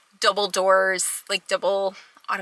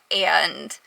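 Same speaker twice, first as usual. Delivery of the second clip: ordinary talk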